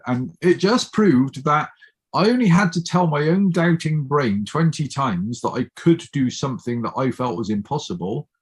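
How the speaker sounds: ordinary talk, nothing marked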